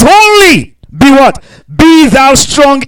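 Be thou strong, each word slow, high and loud; emphatic scripture. Retold or imitated. Pastor voicing God's charge to Joshua, slow and emphatic. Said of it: "he put it an emphasis now".

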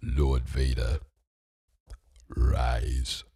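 very low, slow, ominous villain voice; the Emperor. Acted. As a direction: intone it deep and drawn out.

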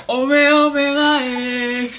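slow, stretched band name; drawn-out announcement. Acted. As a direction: announce it like a host. Announcing the next song.

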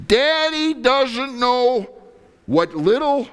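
slow, high, loud preacher's cry; preaching at full force. Acted. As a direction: drive the point home loudly.

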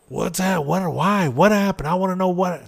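high, loud rapid-fire questions; frantic questioning. Retold or imitated. Wife demanding to know what happened, high and loud. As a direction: pepper him with alarmed questions, voice up high. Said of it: "my wife's all about".

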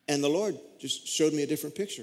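ordinary talk, nothing marked

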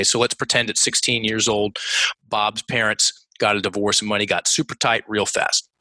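ordinary talk

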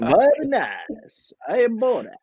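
slow, high Kazakh accent impression; hammy Kazakh accent, slow and drawn out; Borat. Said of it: "I would want a nice Kazakhstan accent".

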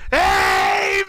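high, loud, drawn-out mock yell; Bobby's shouted outburst. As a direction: bellow it long, way up in pitch. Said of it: "all of a sudden Bobby".

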